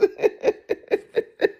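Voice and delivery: breathy high giggle of disbelief; giddy high laugh. Retold or imitated. Peter giggling on the water, high and breathless. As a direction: laugh like you can't believe it.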